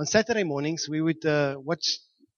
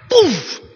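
loud, high poof of exploding bricks; cartoonish loud crash noise; imitated impact; 14 dB louder than usual. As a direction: burst out the smashing sound.

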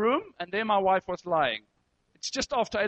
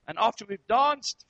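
ordinary talk

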